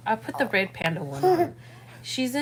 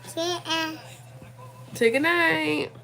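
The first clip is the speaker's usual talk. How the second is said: coaxing; 6 semitones higher, much slower than usual. Real, not acted. Mom coaxing her toddler to say goodnight to viewers. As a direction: coax it gently like a bedtime cue. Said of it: "prompting toddler to say goodnight".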